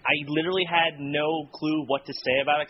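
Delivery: ordinary delivery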